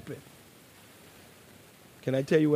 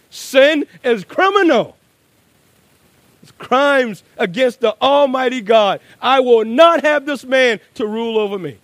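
fiery pulpit denunciation; preaching at full force; 6 semitones higher, 7 dB louder than usual. Acted. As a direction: thunder it at the congregation. Preaching climax.

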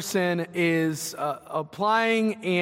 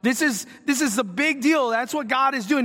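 ordinary delivery

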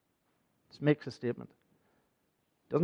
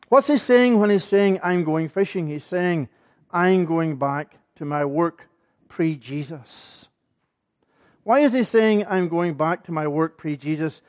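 raised, higher and louder pulpit preaching; fervent preaching; 4 semitones higher, 8 dB louder than usual. Acted. Preacher rousing the congregation about Peter's restoration, fervent. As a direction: drive the sermon home with rising fervour. Preacher builds to full force.

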